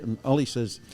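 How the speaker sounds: normal talking voice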